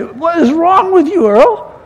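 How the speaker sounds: mock-scold like a fed-up mom; upset mother. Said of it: "my mother would get so upset and say".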